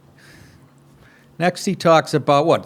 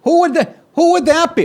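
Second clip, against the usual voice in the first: higher, louder mock puzzlement; sarcastic mock question; 10 semitones higher, 5 dB louder than usual. Host mocking the president's clip, sarcastic higher voice. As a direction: feign innocence, dripping sarcasm.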